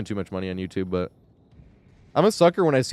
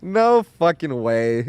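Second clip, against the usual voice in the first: laughing disbelief; 5 semitones higher, 4 dB louder, much slower than usual. Retold or imitated. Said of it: "I'm like".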